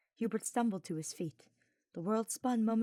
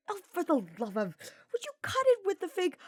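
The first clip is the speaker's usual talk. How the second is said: hissed; 12 semitones higher, 6 dB louder than usual. Acted. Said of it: "Sylvain hissed".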